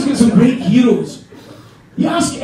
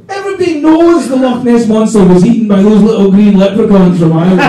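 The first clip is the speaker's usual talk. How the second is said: loud punchline; 8 dB louder than usual. Real, not acted. Punchline delivered at full volume.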